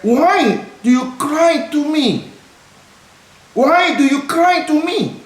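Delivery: drawn-out, deliberate English quote; slow emphatic reading. Shown as a demonstration. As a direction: read every word slowly like scripture. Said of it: "E S V katakan".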